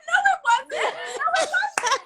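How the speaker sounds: high-pitched mock outrage; retold indignant protest. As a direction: contradict it in a high, comically offended voice. Acted protest against the broadcast clip.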